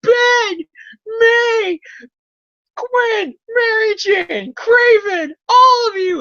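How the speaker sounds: high, loud anguished screams; mock screaming. Peter screaming at his dead cast, high loud anguished screams. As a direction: scream each name like he's losing his mind. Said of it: "he starts screaming".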